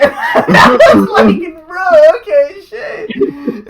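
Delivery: loud, high, bursting with laughter; cracking up, voice shooting upward; laughing shout. Real, not acted. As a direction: shout it through a fit of laughing. Cracking up at own retold reply.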